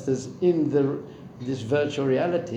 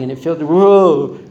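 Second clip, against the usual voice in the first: excited whoa; 2 semitones higher, 12 dB louder, slower than usual. Real, not acted. Recalling flying on a pterodactyl.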